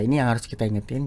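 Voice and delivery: ordinary talk, nothing marked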